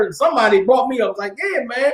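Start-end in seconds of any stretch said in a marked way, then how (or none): 1.4-1.9 teasing classmate. Retold or imitated. Classmate calls him out.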